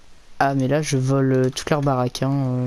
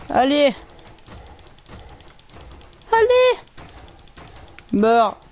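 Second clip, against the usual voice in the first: excited yelling; 10 semitones higher, much slower than usual. Real, not acted. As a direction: cheer on the kill. Fighting mob, cheering on.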